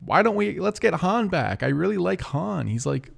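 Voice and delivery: lightly acted fan plea, pitched high; pleading fans. Voicing fans asking for Han back.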